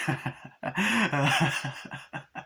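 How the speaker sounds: imitated manic cackle; long mock demonic cackling; demonic laugh. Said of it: "like, really demonically".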